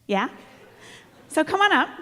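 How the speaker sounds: normal talking voice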